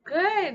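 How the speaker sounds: good said loud and high with a clear back G; modeled word good. Shown as a demonstration. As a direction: hit the G in good, back of the tongue. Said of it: "scooch our tongue back and say".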